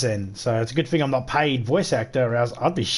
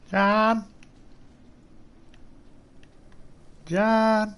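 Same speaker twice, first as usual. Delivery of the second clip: higher, drawn-out mock call; 7 semitones higher, much slower than usual. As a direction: call out a name, pitched up. Calling out for game character.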